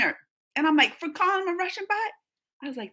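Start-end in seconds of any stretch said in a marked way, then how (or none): 1.0-2.1 high, quick incredulous question; retold disbelief. Retelling reaction to a ban for comments, incredulous. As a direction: question it, pitched right up.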